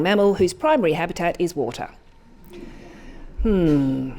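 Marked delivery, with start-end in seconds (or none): 3.4-4.1 a low, thoughtful hmm from the creature; a lightly voiced pondering hmm; musing hmm. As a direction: weigh it up with a low hum. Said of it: "thought the element".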